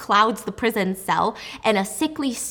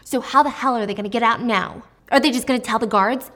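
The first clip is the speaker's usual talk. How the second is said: usual speaking voice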